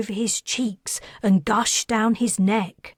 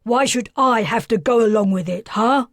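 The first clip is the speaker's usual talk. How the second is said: throwing it back at his wife; angry quarrel; 2 semitones higher, 6 dB louder than usual. Acted. Father argues with wife.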